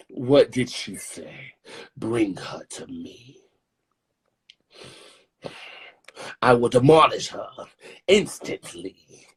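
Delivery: slow menacing diva, whispered then loud; drag diva threat. Acted. Drag persona threatens rival.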